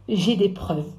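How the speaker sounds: usual speaking voice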